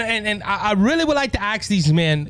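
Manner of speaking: ordinary delivery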